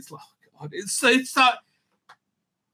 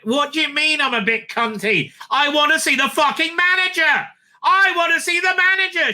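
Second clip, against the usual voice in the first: shrill high furious Karen, much higher and louder; Karen demanding manager; 11 semitones higher, 4 dB louder than usual. Retold or imitated. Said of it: "a definite Karen".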